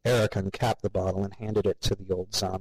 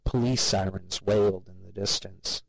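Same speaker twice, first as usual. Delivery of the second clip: ordinary delivery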